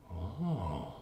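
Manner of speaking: quiet realization. Acted. Retold reaction on reading paper.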